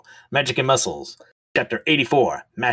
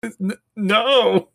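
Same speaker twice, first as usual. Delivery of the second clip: anguished cry; 9 semitones higher, 4 dB louder than usual. Acted. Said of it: "he screams into the sky".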